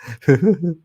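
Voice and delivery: a real burst of laughter, high and loud. Real, not acted. Laughing at herself on an old video, a loud high laugh. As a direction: let a big laugh out.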